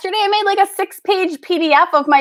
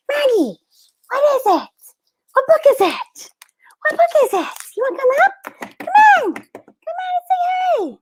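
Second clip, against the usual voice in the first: high-pitched pet talk, much higher than usual; coaxing pet talk; 12 semitones higher than usual. Real, not acted. Owner calling Maggie up to say hi, coaxing. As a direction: coax the pet in a high, sing-out voice.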